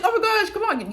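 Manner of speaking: high-pitched mock panic; imitated freak-out. Crew retold scrambling to escape the explosives, freaking out. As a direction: act out people freaking out, voice jumping up. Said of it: "So they're freaking out".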